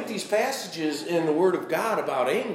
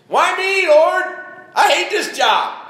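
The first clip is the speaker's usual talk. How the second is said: much higher, louder anguished whine; self-pitying wail; 12 semitones higher, 9 dB louder, slower than usual. Acted. Preacher mimics someone griping to God, high loud wail. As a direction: cry out to heaven like a sulking martyr. Acted complaining prayer.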